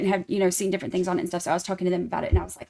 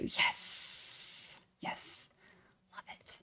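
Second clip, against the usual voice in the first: whisper; 16 dB quieter, much slower than usual. Acted. Whispered asides.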